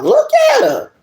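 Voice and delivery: very high-pitched squealed exclamation; excited squeal. Real, not acted. Reader exclaiming over the card, excited squeal. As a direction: squeal it right at the top of the voice.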